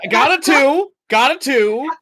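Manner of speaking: loud, high-pitched exclamation; excited outburst. Real, not acted. Player announcing a roll of two, excited outburst. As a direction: blurt it out high and loud to the table.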